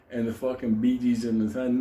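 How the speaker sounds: usual speaking voice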